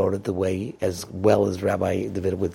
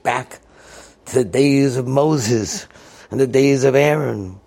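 loud master-of-ceremonies build-up; raised, showy public address; announcer on the microphone; 3 semitones higher, 6 dB louder than usual. Retold or imitated. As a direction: introduce him grandly to a crowd. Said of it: "Zeke gets up on the microphone".

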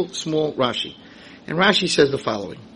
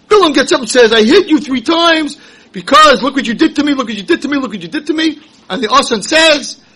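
lively preaching; 9 semitones higher, 8 dB louder than usual. Acted. Preaching the story.

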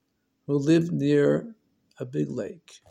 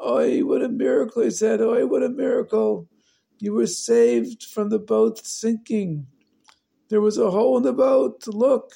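joyful relief; 6 semitones higher, 3 dB louder than usual. Acted. Father overjoyed, hugging the children.

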